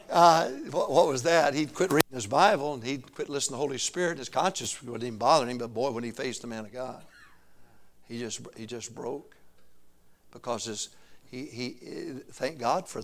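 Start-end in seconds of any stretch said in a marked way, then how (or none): none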